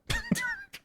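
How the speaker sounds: short high, soft laugh. Real, not acted. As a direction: squeak out a quick laugh. Laughs at own mistake.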